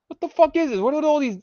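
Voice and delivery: high, loud disbelieving yell; real shocked outburst; outraged disbelief. Real, not acted. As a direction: shriek in disbelief at the chat. Outraged at chat.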